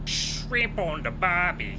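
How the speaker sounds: high, slow, hammed-up Australian accent; mock Australian twang, deliberately bad; terrible Australian accent. Shown as a demonstration. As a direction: drag "Shrimp on the barbie" out in a bad Aussie accent. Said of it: "that's a terrible Australian accent".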